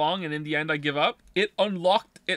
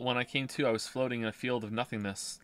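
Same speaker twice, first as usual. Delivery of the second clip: story narration; 7 semitones lower, 10 dB quieter than usual. Acted. Reads game narration aloud.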